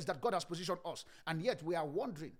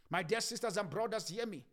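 usual speaking voice

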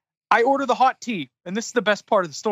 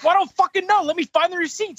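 retold huffy comeback, much higher than usual; exasperated retort; 8 semitones higher than usual. Customer asked by manager for the receipt, exasperated. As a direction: pitch shoots up in mock annoyance.

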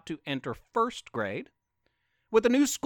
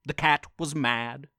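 ordinary delivery, nothing marked

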